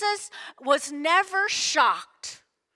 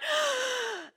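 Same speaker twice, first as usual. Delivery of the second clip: mock gasp of shock; 10 semitones higher, 4 dB quieter than usual. Shocked at list of sins.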